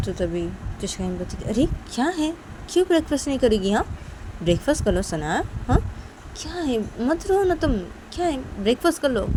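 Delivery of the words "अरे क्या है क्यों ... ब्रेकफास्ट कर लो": lightly voiced mother, higher than the narration; a hint of an exasperated mum; mildly scolding mother. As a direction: coax a crying child with mild exasperation.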